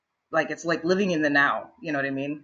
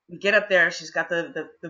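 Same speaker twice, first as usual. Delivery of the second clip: ordinary talk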